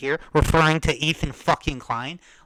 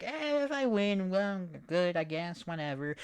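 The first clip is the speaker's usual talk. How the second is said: mock indifference; 2 semitones higher, 6 dB quieter than usual. Imitating the boxer shrugging off winning, fading mock. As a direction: let it trail off like you don't care.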